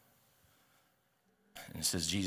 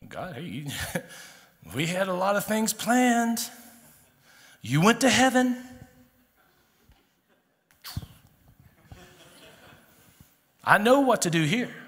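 Peter shouting at God; 12 semitones higher, 11 dB louder than usual. Acted. Peter shouting up at God after Jesus left. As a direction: yell it up to heaven like a fed-up disciple.

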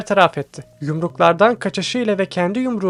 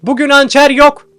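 loud, high barked order to stop; shouting; 7 semitones higher, 9 dB louder than usual. A narrator's reading. Professor shouting at fighting students, loud and high. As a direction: shout it across the mat. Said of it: "diye bağırdı".

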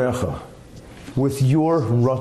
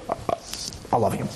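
softer, half-hearted mumble; mumbled by rote; 4 semitones lower, 7 dB quieter, much faster than usual. Shown as a demonstration. A spouse mumbles love by rote, quiet and flat. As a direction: mutter it like a chore. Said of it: "That's not love".

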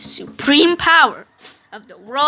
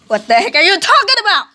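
fast, high, loud; panicked outburst; 7 semitones higher, 7 dB louder, faster than usual. Acted. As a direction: blurt it in alarm.

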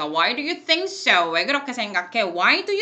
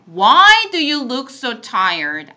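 emphatic model read; 9 dB louder, much slower than usual. Shown as a demonstration. Model sentence read aloud.

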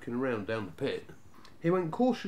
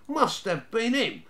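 put-on accusing Snarget boy; 9 semitones higher, 5 dB louder than usual. A narrator's reading. Snarget blames youngest brother.

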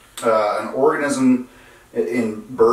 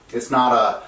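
usual speaking voice

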